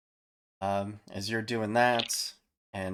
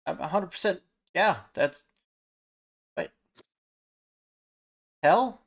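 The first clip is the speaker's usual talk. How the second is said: lookout voice; 9 semitones higher, 5 dB louder than usual. Acted. Lookout answers, then spots ships.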